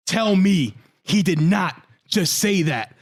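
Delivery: slow, put-on voice of stunned disbelief; mock Booker T disbelief. Booker T reacting to Vince, in a slow stunned voice. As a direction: drag it out like you can't believe it.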